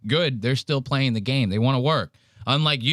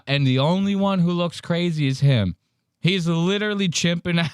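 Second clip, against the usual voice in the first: ordinary talk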